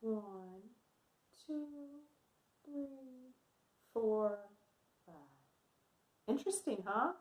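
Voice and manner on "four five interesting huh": ordinary talk, nothing marked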